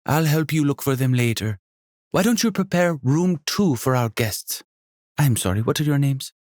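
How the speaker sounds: high, lively hostess voice with a faint Jamaican lilt; a welcoming, bubbly landlady; energetic innkeeper. Acted. Sonia to son and guests.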